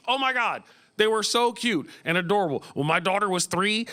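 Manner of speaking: normal talking voice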